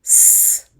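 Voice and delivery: S as a long, loud hiss "sss"; sustained "sss" for S; letter sound. Shown as a demonstration. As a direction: hiss the sound of S, not its name. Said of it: "now let's write them".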